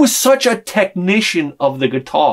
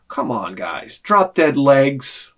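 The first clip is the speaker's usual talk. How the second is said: normal talking voice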